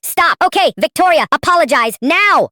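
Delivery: very high, loud panicked shriek, slowing on apologize now. A narrator's reading. Mother shrieking at grandpa and daughter in panic. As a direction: shriek in terror at the gasoline. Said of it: "Mom shrieked".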